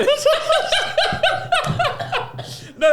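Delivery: high laugh. Real, not acted. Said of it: "you went so high pitched then".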